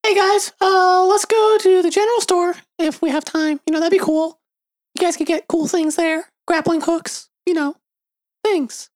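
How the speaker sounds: high squeaky voice. Acted. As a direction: pitch it way up, squeaky and eager. Brimley's voice held.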